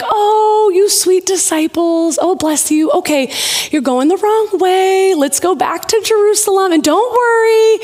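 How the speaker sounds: high, singsong mock sweetness; patronizing. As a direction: coo as if to clueless little ones. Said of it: "of a patronizing way".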